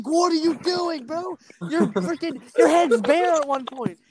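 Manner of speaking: high, stunned "what are you doing, bro"; shocked yell. Retold or imitated. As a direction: react in disbelief to the bald strip. Shocked at friend's cut.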